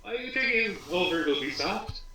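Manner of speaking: high, laughing mock interviewer; hammed-up reporter demanding answers; mock pushy reporter. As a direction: play a pushy journalist at the door. Pretend reporter grilling executives.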